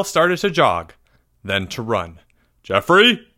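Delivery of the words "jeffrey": raised, louder call. A narrator's reading. Teacher calling after runaway pupil, calling out loudly. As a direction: call a name into the distance. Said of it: "he called out".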